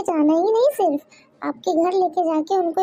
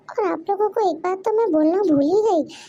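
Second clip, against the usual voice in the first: usual speaking voice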